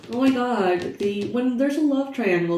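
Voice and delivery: normal talking voice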